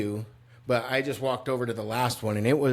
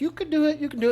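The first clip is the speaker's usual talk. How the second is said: very high, sing-song cheering on; wife coaching him across the zip line, high and encouraging; coaching encouragement; much faster than usual. Retold or imitated. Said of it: "she's just coaching me like".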